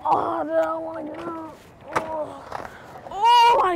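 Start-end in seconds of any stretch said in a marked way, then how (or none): 3.1-3.8 sharp high scared yelp. Real, not acted. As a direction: yelp as you nearly fall. Nearly falls on skateboard.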